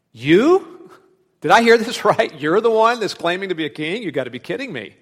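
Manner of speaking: mocking disbelief. Pilate mocking Jesus' claim to be king, loud and scornful. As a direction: sneer it out with a laugh, fading at kidding me. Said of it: "tone of surprise or this tone of disdain".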